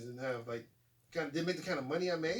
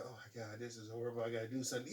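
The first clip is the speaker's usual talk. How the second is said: quiet self-talk; 4 semitones lower, 7 dB quieter than usual. Retold or imitated. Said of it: "And I was like".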